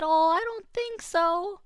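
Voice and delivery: very high, slow, doubtful squeak; a hopeless little rabbit. Acted. Rabbit doubting any carrots survived, high and slow. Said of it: "And he said".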